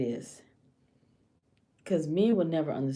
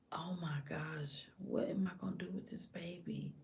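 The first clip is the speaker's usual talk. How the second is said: near-whispered acted worry; worried inner thought; 12 dB quieter than usual. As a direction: breathe it out as a private thought. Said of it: "all this stuff running through her head".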